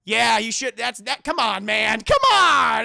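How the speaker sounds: exasperated protest. Retold or imitated. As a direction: build to a hammed-up exasperated shout.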